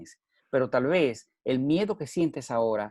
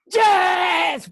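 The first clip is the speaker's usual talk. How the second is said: a much higher, loud retold yell; shouted yes; 8 dB louder than usual. Speaker reliving his yell alone in the car. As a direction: belt it like a battle cry. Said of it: "y grité".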